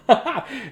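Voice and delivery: high burst of laughter; amused laugh at an accidental rhyme; sudden high-pitched laugh. Real, not acted.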